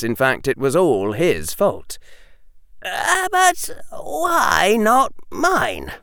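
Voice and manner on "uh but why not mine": put-on high, slow, wounded boy; plaintive Harry. Harry asking Hermione why not his army, plaintive. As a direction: plead in a high, drawn-out voice.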